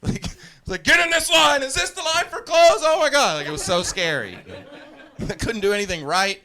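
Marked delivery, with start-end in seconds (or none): 0.8-3.4 frantic high-pitched shouting; loud, high, panicked retold yelling. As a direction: scream it in boot-camp chaos. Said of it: "getting yelled at by these big dudes".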